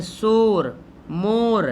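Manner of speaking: "o" sound, not "oo", in शोर and मोर; unstretched vowel. Shown as a demonstration. Teacher showing waav majhool, plain ओ in शोर, मोर. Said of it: "जिसको खींच कर ना पढ़ा जाए".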